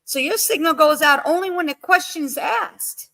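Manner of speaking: high, disbelieving sarcastic question; incredulous. Retold or imitated. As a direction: act like you can't believe the excuse. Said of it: "I'm like".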